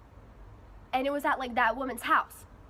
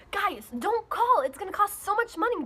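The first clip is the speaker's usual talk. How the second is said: scolding; 8 semitones higher, 4 dB louder than usual. Retold or imitated. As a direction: drag it out like a fed-up older sister. Scolding little brother on phone.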